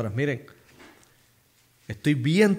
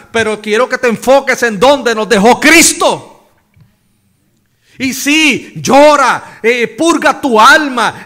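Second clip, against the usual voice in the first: louder comforting pulpit cry; fervent preaching; 4 semitones higher, 10 dB louder than usual. Acted. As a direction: urge the weary to weep and rest. Voices God's comforting words.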